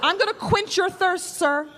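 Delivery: sing-song mommy talk to a grown man; mock mommy baby talk. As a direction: coo at him like a mother with a toddler. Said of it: "like you're their mommy".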